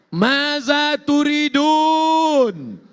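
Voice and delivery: high, loud, long-drawn proclamation; booming divine question. Acted. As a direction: call it out grandly, stretching the vowels. Allah questions those in paradise.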